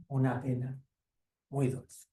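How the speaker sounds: closing the story for the listeners, softly; soft closing title. Shown as a demonstration. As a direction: say the title softly, as the book closes. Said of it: "closing the story with its title".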